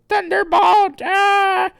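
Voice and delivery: high, loud cartoon battle cry; attack scream. Fighter unleashes a move, screaming it. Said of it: "and then he goes".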